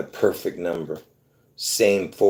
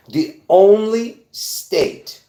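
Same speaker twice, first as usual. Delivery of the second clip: big build-up, higher and louder; dramatic reveal; 11 semitones higher, 6 dB louder, much slower than usual. Real, not acted. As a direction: drag "the only state" out slowly for suspense.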